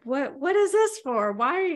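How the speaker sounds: husband caught off guard by a surprise gift; surprised husband. Retold or imitated. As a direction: ask it like a puzzled husband handed a present. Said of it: "And John's like".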